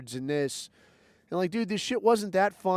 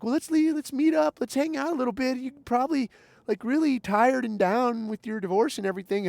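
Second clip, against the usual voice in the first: put-on much higher, sweet-talking old school friend; imitating the girl; 7 semitones higher than usual. Old school friend inviting him out after his divorce, higher mock voice. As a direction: mimic her higher, eager invitation. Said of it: "this girl's like".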